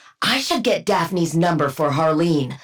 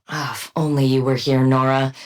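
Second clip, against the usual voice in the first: ordinary delivery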